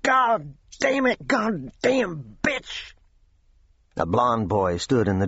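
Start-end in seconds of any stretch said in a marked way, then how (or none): 0.0-2.9 high, slow, furious cursing; angry young man bursting in the doorway, cursing; sharp angry curse. A narrator's reading. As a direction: spit the curse out in rage. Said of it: "Suddenly a sharp voice cut the air".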